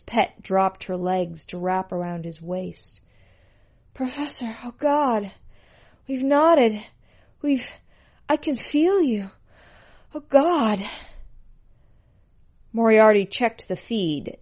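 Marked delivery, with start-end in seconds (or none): 3.9-10.8 high, gasped-out short phrases; overwhelmed pet. A narrator's reading. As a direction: marvel in halting, high-pitched bursts. Pet overwhelmed after climax.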